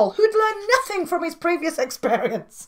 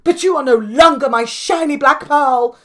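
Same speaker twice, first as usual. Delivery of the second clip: louder hurt cry; 9 dB louder than usual. Acted. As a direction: cry it out in disappointment. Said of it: "cried the caterpillar".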